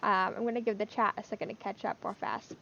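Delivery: usual speaking voice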